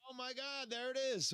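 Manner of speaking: faint, distant voice on a bad line; as if far from the mic; barely audible. Real, not acted. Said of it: "now I can barely hear you".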